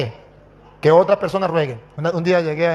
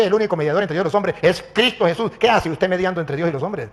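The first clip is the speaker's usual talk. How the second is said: ordinary talk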